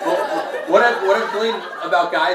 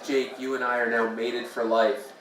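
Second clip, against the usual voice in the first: soft alien lady voice; 6 semitones lower, 6 dB quieter than usual. Retold or imitated. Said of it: "you hear her voice and she says".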